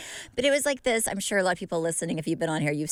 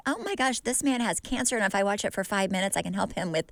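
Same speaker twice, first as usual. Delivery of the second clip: mock alarmed inner voice; 7 semitones higher than usual. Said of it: "I'm like".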